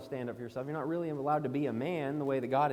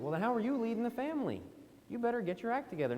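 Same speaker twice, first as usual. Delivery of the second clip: higher and faster, a sharp talking-to; scolding rebuke; 7 semitones higher, faster than usual. Retold or imitated. Preacher telling a self-pitying husband to shape up, sharp and quick. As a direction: snap him out of it like a stern older brother. Rebuking a man who belittles himself.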